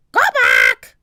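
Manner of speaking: loud, screeching parrot voice; parrot squawk. A narrator's reading. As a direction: screech the words like a bird. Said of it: "squawked Polly".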